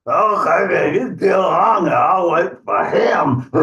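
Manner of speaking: high troll voice, chuckling after; greedy troll. Acted. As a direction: agree eagerly as a hungry troll. Troll agrees to wait for Gruff.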